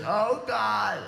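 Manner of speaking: high, slow, pleading moan; old woman under the car begging, high and slow; dying old woman. Retold or imitated. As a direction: beg feebly for death. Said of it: "she looks at you and she says".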